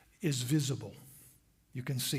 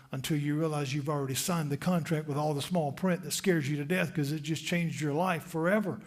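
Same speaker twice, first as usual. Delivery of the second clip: ordinary talk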